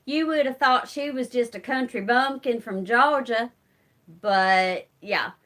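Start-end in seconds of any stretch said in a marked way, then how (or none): 0.1-3.5 put-on thick Southern accent. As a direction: imitate a mother's thick rural Southern accent. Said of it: "My mother used to talk like this".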